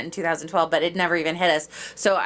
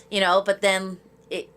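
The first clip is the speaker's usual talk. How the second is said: ordinary delivery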